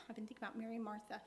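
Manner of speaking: normal talking voice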